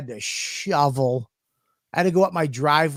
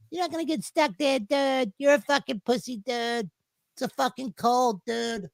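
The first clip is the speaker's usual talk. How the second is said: much higher, softer mocking scoff; 9 semitones higher, 4 dB quieter than usual. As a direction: sneer at a scared friend. Mocking friend's fear of covid.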